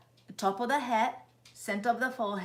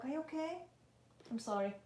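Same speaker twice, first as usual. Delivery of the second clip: high and soft, cooing; tender to dog; 4 semitones higher, 9 dB quieter, much faster than usual. Real, not acted. Soothing her old shelter dog, soft high coo. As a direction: comfort a frightened pet.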